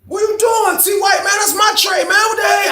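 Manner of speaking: high-pitched shouting as the upset man whose tray got taken; high yell. Retold or imitated. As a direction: shout him down over a stolen tray. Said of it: "He was like".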